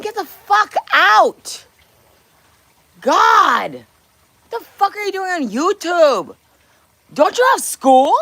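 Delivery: high, loud, slow mock disbelief; hammed-up shock at a kid on YouTube; mock outrage. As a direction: drag it out, scandalised and loud. Mock outrage at young viewer.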